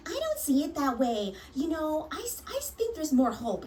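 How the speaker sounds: high, mock-earnest doctor voice, cracking up high on I s-; hammed-up imitation of a condescending doctor. Mocking the doctor who dismissed her, high and hammed-up. Mocks doctor during argument.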